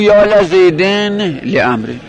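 slowly, لزیدن with "la", لامرن with "le"; kasra on second lam. Shown as a demonstration. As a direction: say لامرن as "le-amre" after "la-zeyden" for لزیدن. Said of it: "لام رو به کسر بخون".

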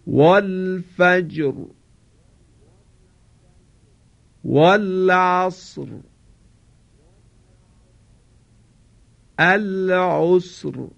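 slow Quranic recitation, the ر in والفجر، والعصر، العسر said thick and heavy; heavy emphatic R. Shown as a demonstration. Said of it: "منتها باید دقت کنید که را تفخیم بشه".

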